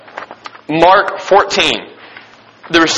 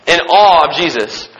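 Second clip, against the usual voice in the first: normal talking voice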